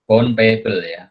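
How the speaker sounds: ordinary talk, nothing marked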